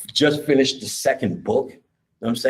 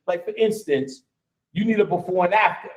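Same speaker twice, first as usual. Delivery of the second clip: normal talking voice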